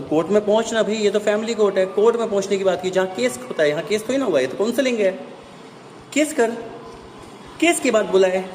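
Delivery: ऊँची आवाज़ में उत्तेजित होकर बहस; झल्लाकर तेज़ी से तर्क देना. Real, not acted. (Family court पर भड़कते हुए, ऊँची आवाज़ में बहस)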